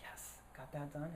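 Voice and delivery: a breathless little whisper of relief. Retold or imitated. Relieved aside to himself outside.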